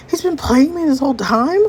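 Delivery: high-pitched indignant question; just found out you've been played; outraged disbelief. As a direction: voice climbing in hurt disbelief. Acted discovery of being cheated on.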